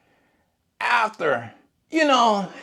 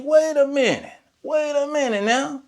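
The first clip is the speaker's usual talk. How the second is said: very high-pitched retold protest; acted-out startled objection, voice jumping up; taken aback; 11 semitones higher than usual. Lawn worker answering an arrogant neighbor, high startled protest.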